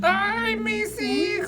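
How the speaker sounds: high wailing La Llorona cry; weeping ghost woman. Acted. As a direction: wail like a grieving ghost mother. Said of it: "Like that?".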